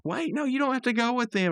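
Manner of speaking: high-pitched imitated plea; mock protest. Rigby stopping Mordecai from leaving, high mock protest. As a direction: plead like a cartoon friend left behind.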